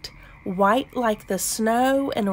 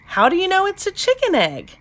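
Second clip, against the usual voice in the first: high, quick, puzzled storybook voice; wondering question; 9 semitones higher, much faster than usual. A narrator's reading. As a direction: ask it quickly, pitched up in wonder. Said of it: "asked Jessica".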